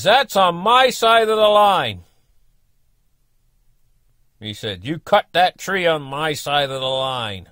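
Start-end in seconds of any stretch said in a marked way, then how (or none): 0.0-2.0 loud, slow, higher indignant neighbour; a riled-up neighbour accusing him; angry accusation. Retold or imitated. 4.8-7.5 slow, loud accusing neighbour; an elder neighbour scolding; angry accusation. Retold or imitated. Neighbour accusing him of trespass.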